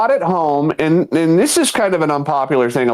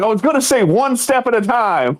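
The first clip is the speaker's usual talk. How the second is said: high, mock indignant line; mock hurt coworker; 9 semitones higher than usual. Coworker explaining the joke he meant, high and indignant. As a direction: act wronged, half laughing. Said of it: "He was like".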